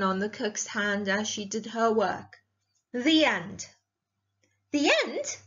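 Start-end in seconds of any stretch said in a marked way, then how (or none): 4.7-5.4 high, indignant echoed question. A narrator's reading. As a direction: throw it back in disbelief.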